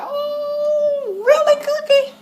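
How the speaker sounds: squealing oh, really, Cookie; high shocked cry. Real, not acted. Viewer reacting to Cookie's line, squealing. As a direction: shriek it in disbelief. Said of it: "I was like".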